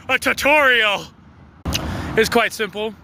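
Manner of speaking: big showy punchline delivery; mock-dramatic reveal. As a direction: announce it like a grand, dreadful reveal.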